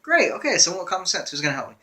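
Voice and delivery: sarcastic, fed-up outburst; exasperated. Retold or imitated. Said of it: "I'm like".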